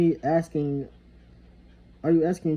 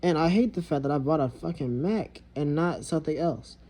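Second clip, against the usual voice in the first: ordinary delivery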